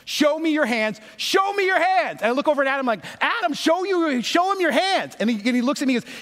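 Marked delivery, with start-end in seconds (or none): 0.0-2.2 high-pitched mock yell, rising with each repeat; retold yelling. Cop ordering the friend to show his hands, mock yelling. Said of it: "he starts yelling at Adam". 3.2-5.2 high-pitched retold panic; urgent plea. Passenger urging his friend to obey the cop, high urgent plea.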